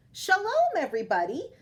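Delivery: high, bright call of welcome; old woman welcoming friends at her door; glad cry. Acted. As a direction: call out a happy greeting. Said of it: "Baba Braina cried".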